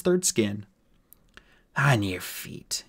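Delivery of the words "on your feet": slow goblin command; drawn-out reptilian menace; reptilian order. Acted. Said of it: "it sounded reptilian".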